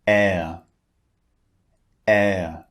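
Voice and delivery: "Air" as a glide from wide to relaxed, no R at the end; British air vowel. Shown as a demonstration. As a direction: stretch "air" so the mouth moves from wide to relaxed and drop the R. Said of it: "the shape of the mouth changes slightly".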